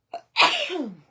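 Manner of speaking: loud sudden sneeze; real sneeze, loud and high; sneezing. Real, not acted. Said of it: "I have to sneeze".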